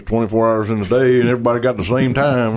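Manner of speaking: put-on smart-aleck Texas accent. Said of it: "There's that Texas accent".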